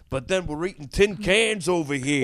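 imitating a Catskill comic; New York comic voice. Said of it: "But when you go".

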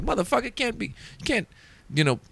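high, mock angry hallway rant; imitating pissed rant. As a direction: rant like a furious headliner. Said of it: "So he's pissed".

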